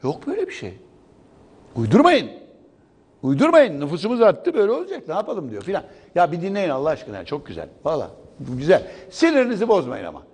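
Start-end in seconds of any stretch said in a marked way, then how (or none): 0.0-5.5 lightly imitated leader brushing it off, pitched high; mock presidential decree. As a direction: mimic a ruler waving complaints away. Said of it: "padişahımız diyor ki".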